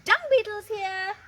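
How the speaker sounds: mother calls out the arrival; Boa's mother calling. Acted. Mother announces guest.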